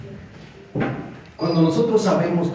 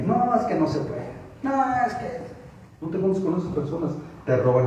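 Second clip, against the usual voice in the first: high, put-on defeatist whine; mock discouraging whine; 3 semitones higher, 6 dB quieter than usual. Preacher mimics people who discourage you, mock whining. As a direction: whine it like a naysayer, rising higher.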